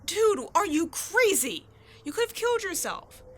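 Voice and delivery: rattled after a close call; shaken scolding. Acted. Shaken after near accident.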